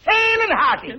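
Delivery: high, emphatic hale and hearty; exuberant repeat. Acted. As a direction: repeat it with a laugh. Repeated higher.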